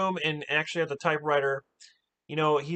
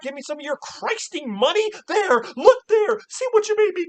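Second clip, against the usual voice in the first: high, loud furious tirade; a mock rage, louder and higher throughout; imitated screaming rant; 10 semitones higher, 7 dB louder than usual. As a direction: scream it at him like she's lost it. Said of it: "starts screaming at the top of her lungs".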